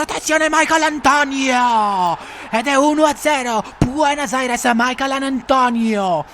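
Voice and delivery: high, loud goal call; breathless italian goal commentary. Acted. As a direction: call the goal with full excitement.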